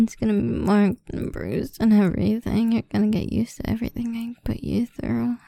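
ordinary delivery

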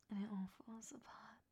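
ordinary talk, nothing marked

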